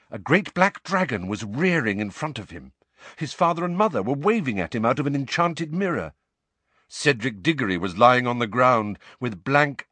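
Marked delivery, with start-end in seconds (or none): none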